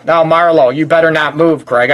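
ordinary talk

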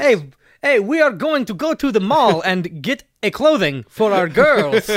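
chirpy broken-English Eastern European man; mock Eastern European accent. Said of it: "said through the guise of the European man".

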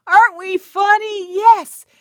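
high, loud, giggling through the words; laughing. Real, not acted. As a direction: laugh at your own joke. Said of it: "It just feels good to laugh".

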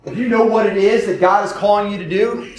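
usual speaking voice

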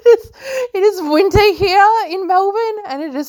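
cold, breathing funny; talking while freezing; shivering from cold. Real, not acted. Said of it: "I'm freezing".

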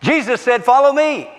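high, exultant sermon climax; fired-up preacher with a whoop; shouted preaching. Real, not acted. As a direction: cry it out to the whole church.

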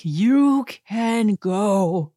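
grand, slow kiss-off; mock dismissal. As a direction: stretch every word like showing someone the door. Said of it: "And we're all like".

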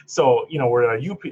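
ordinary delivery

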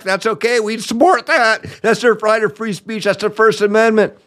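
high-pitched mock officialese; mocking quote. Mockingly voices officials defending free speech.

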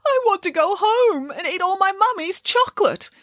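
put-on high, whining little-child wail. Caged puppet longs for home and mummy, wailing. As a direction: bawl it out like a homesick child. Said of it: "wailed Bramwellio".

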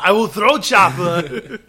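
put-on clunky action-hero accent; Arnold Schwarzenegger. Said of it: "Arnold Schwarzenegger".

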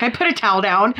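giggling excuse; laughing. Retold or imitated. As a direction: laugh while explaining. Retold drunk excuse.

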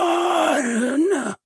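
high, desperate shouted warning; frantic cry. Acted. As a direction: cry out in panic. Clyde warns of creature.

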